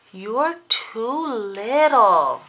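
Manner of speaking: loud, slow brush-off; annoyed brother. Acted. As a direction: push the little sister away, slowly. Felix brushing off his sister.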